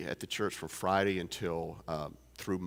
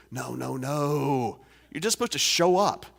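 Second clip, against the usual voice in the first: put-on an old farmer setting the pastor straight, loud and emphatic; emphatic farmer insisting; 6 semitones higher, 8 dB louder than usual. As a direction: drag out the no's, then burst out with the rest. Ernie insists, voiced.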